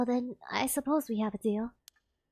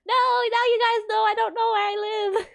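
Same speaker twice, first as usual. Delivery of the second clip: laughing; 10 semitones higher, 5 dB louder than usual. Real, not acted. Embarrassed laughing at own story.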